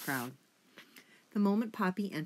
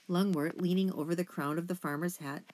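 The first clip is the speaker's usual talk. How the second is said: ordinary delivery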